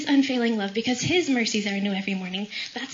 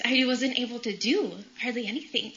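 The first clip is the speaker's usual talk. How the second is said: ordinary talk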